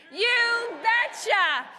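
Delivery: loud, high, drawn-out mocking cheer; very slow, shouted crowd chant; Tom Tiffany catchphrase. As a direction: belt out a borrowed catchphrase, stretching both words. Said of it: "as Tom Tiffany would say".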